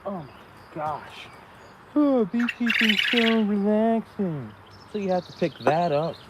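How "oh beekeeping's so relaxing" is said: sarcastic mock quote. Mocking a cliché about beekeeping.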